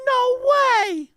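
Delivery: high, amazed "no way". Retold or imitated. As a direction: gasp it out like a kid hearing a wild fact. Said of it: "And I was like".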